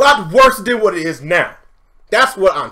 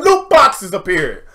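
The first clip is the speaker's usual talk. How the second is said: ordinary talk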